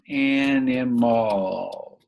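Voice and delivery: "Animals" stretched very slowly; "Animals" in long, slow syllables; drawn out while writing. Shown as a demonstration. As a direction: say "Animals" slowly, drawing out each syllable as you write it. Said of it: "let's just write the word".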